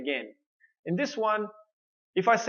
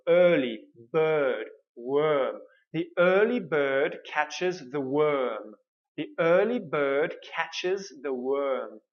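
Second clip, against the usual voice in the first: slow, careful, overarticulated; slow clear model; slower than usual. Shown as a demonstration. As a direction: say it slowly, every sound clear. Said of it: "So, again".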